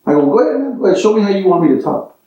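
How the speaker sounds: ordinary delivery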